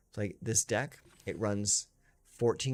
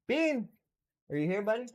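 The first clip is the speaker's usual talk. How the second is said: much higher coaxing call; calling the dog; 12 semitones higher, faster than usual. Real, not acted. Owner calling old Bean up for the camera, high coaxing call. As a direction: sing the name up high to lure her in.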